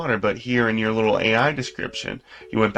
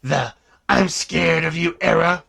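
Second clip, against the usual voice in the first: dramatic villain monologue; 5 semitones higher than usual. Acted.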